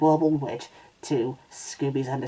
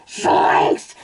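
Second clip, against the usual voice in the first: loud, rough cartoon yell; 5 dB louder than usual. A narrator's reading. Cartoon owner surprised by his dog, yelling. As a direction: yell it out as a startled cartoon man. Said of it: "Shaggy yelled".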